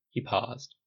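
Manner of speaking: plain reading voice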